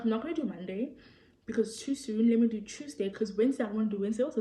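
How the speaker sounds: ordinary talk, nothing marked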